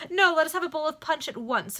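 high, loud, eager proposal; exclaiming. A narrator's reading. Guest proposing a bowl of punch, exclaiming. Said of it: "exclaimed Suggett".

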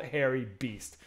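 ordinary talk

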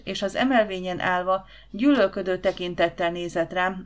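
ordinary delivery

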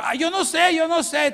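flustered sister. Retold or imitated. Sister dodges the little boy's question, high flustered denial. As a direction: squeal it out, dodging the question.

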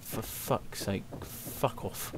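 whispered, slow annoyed curse; sleepy whispered swearing at the birds; exasperated whisper. Retold or imitated. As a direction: mutter it under your breath, half asleep. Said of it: "You're like".

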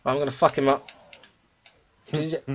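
usual speaking voice

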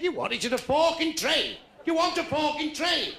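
high-pitched mock server, insistent; shouting at the deaf. Chip shop worker repeating to a customer, high insistent call. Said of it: "They think you're fucking deaf".

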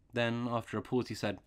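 ordinary delivery, nothing marked

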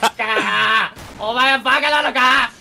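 loud, high, drawn-out scolding; exasperated yelling. Real, not acted. Player asks if the other is an idiot, exasperated yell. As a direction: yell it slowly in sheer exasperation.

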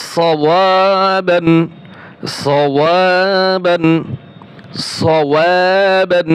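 সওয়াবান drawn out slowly; slow recitation for learners; slow model reading. Shown as a demonstration. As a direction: stretch each syllable of সওয়াবান. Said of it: "teacher models the word slowly".

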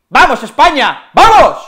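high, loud stadium cheer; cheering shout. Real, not acted. Fan cheering Spain on, high and loud. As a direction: yell it for the team.

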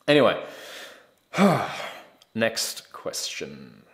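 ordinary delivery

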